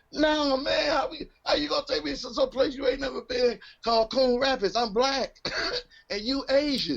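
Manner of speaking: high, crying-laugh disbelief; incredulous. Retold or imitated. To Brian about Coon Rapids, high laughing outrage. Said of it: "I was like".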